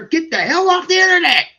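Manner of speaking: loud, high rant; shouting. Real, not acted. Streamer shouting advice to viewers. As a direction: yell it at the dating crowd.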